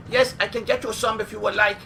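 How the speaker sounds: lightly mock Chinese waiter accent. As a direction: offer food in a hammed-up accent.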